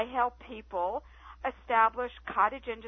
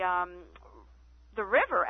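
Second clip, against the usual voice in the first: usual speaking voice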